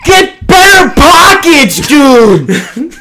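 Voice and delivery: high, slow, drawn-out jab; exasperated heckle. Real, not acted. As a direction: whine it out in mock disbelief. Co-host's mock exasperated retort.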